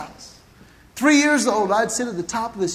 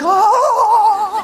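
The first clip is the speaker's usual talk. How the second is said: loud, high startled Oh!; shocked yelp; 9 semitones higher, 6 dB louder than usual. Retold or imitated. Toddler speeding downhill on a tricycle, loud panicked yelp. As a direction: yelp as the tricycle runs away with you. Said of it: "I'm like".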